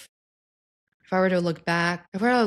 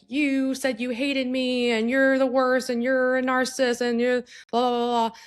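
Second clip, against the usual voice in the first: mock accusation; 7 semitones higher than usual. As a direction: list the grievances in a whiny, pitched-up accusation. An upset person accusing you.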